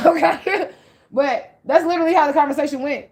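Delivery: high-pitched, amused through a laugh. Real, not acted. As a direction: laugh it off as you insist. Laughs about hearing voices.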